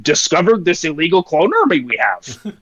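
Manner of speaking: very low, resigned little-creature impression; Yoda. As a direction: croak it low in inverted-syntax pauses. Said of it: "I'm gonna quote my brother, and he must have said".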